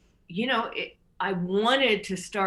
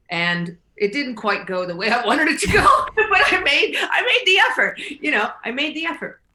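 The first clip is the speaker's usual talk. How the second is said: higher, louder through real laughter; laughing; 8 semitones higher, 9 dB louder than usual. Real, not acted. Host laughing about the talk with her mom. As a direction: laugh it off ruefully.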